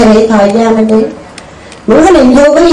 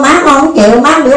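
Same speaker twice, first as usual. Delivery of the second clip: ordinary talk, nothing marked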